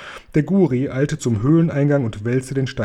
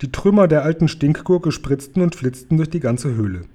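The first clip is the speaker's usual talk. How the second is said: ordinary delivery, nothing marked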